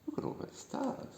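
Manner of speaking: awed little whisper; hushed whisper. Pretend. Reader's hushed aside.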